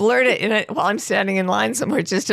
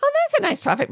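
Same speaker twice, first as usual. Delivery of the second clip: breezy dismissive; faster than usual. Retold or imitated. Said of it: "at first it was like".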